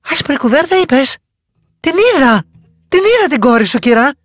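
she realises the lost mother is near; urgent realisation. A narrator's reading. Sudden realisation, urgent promise. (επείγουσα, ψηλότερη και δυνατότερη φωνή)

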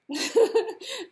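a sudden loud bright laugh; burst of laughter. Real, not acted. Laughs about blank book.

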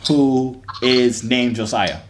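ordinary talk, nothing marked

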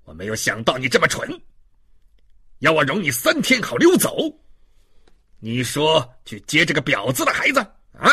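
loud, derisive swings in pitch; scornful jeering. Acted. Inspector mocking the prisoner's request, scornful. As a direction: scoff at a stupid trick.

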